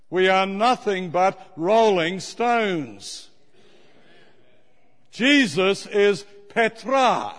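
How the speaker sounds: slow, emphatic pulpit delivery; preaching. Acted. Preacher booming scripture to the congregation, slow and emphatic. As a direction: preach every word like scripture.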